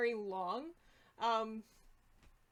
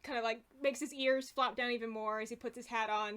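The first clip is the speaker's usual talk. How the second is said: normal talking voice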